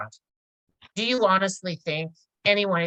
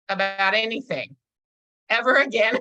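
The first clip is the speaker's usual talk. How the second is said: ever again punched louder, falling hard; mock-stern instructor hitting ever again; punched warning; 4 semitones higher, 5 dB louder than usual. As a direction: lean on ever like a stern judge. Judge's punchline in retold class.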